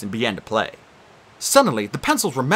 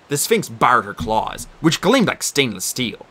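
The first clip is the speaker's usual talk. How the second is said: ordinary delivery, nothing marked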